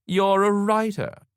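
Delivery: high, loud, drawn-out accusation; scornful accusation. A narrator's reading. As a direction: make the word an insult, slowly. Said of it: "not using the word as a compliment".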